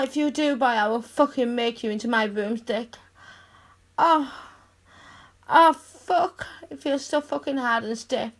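ordinary talk, nothing marked

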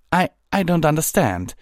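high, loud, stammered confusion; bewildered. A narrator's reading. Twilight replies to the captain's warning, high and bewildered. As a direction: stammer it out high, not understanding.

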